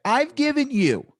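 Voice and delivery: high-pitched exasperated rant at a heckler; worked-up scolding, easing off at the end; loud, upset. Acted. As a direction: blow up at them after trying everything. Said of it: "like, loud a couple of times".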